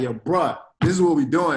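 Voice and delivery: pushy friend. Retold or imitated. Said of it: "Uche pushed me. Uche was like".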